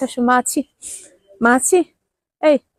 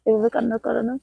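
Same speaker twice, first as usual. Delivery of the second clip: soft soothing; 3 semitones lower, 4 dB quieter than usual. Real, not acted. Soothing a crying child.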